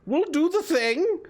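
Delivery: high, hospitable hag voice; hag character. Acted. Hag invites the tired party to nap, high hag voice. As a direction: play the eager hostess hag.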